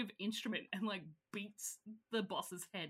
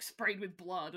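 usual speaking voice

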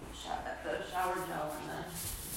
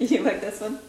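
laughing; 6 semitones higher, 14 dB louder, faster than usual. Real, not acted. Laughing while showing a perfume.

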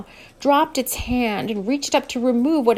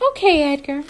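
put-on young woman voice; 5 semitones higher, 5 dB louder than usual. Amber voiced.

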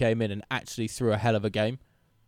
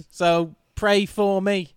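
slow, higher Scouse plea; drawn-out Liverpudlian sob-story appeal; mock Liverpudlian; 5 semitones higher, 4 dB louder, much slower than usual. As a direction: beg for sympathy in put-on Scouse. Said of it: "Don't know why I went Liverpudlian".

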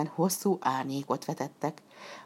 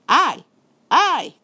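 loud, higher shout of stop; 12 semitones higher, 9 dB louder than usual. A narrator's reading. Robbers shout after the fleeing puppet, loud and high. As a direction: yell after him to halt. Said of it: "kiáltottak utána a rablók".

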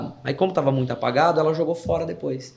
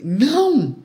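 high-pitched frightened yelp of no; retold scream; 7 semitones higher than usual. Child stops mother removing the neck collar, high acted scream. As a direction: cry out a sudden, drawn-up no. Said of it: "eu gritei".

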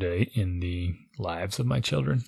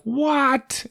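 high squeaky disbelief, both words pitched way up; shocked kids. Retold or imitated. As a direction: mimic kids outraged at the new rule. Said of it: "They're like".

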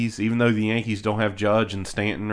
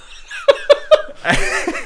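squealing high laugh; real laughter breaking out; high burst of laughter; 5 dB louder than usual. Real, not acted. Laughing at own story.

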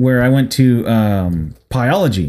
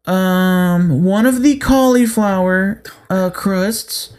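higher and slow, stretched hesitant order; mock dithering customer; 5 semitones higher, much slower than usual. Mimicking the slow lady ahead in line, higher and slow.

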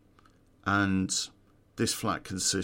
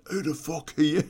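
higher, rising parroting of the question; mock echo; 7 semitones higher, 4 dB louder than usual. Man in bed echoing the intruder's question, higher and baffled. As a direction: throw the question straight back, baffled. Guy echoes the question back.

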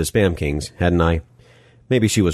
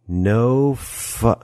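higher, drawn-out objection broken off; cut-off protest; 5 semitones higher than usual. Acted. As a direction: protest chopped off mid-word. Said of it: "leaving my protest half-voiced".

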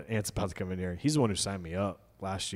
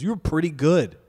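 higher, louder mock begrudging praise; mock grudging compliment; 6 semitones higher, 7 dB louder than usual. [name] grudgingly praising his friend after a good set, mock pained. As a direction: spit out the compliment like it hurts.

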